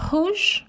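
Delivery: rouge as roozh, high bright rise; French pronunciation. Shown as a demonstration. Eagerly asking children to repeat rouge. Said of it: "Can you say".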